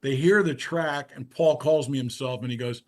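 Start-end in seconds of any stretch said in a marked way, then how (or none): none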